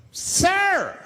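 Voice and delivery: high, loud mock yell; freaked-out passenger. Passenger calls out the smoker, freaked out. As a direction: yelp it out in outrage. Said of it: "people freaked out".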